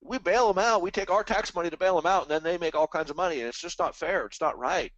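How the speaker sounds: high, whiny imitation of complainers; mock whiny complaint. Mocking bailout complainers, high whiny imitation. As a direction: mimic the public whining that it's not fair, high-pitched. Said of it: "everybody's whining and crying, going".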